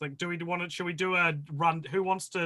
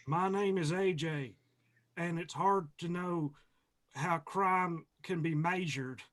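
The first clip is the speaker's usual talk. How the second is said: put-on Texas accent; 3 semitones higher, 3 dB quieter than usual. Film podcast host introducing himself with a movie line, Texas accent. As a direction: deliver it in a Texan accent. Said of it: "our best Texas accent".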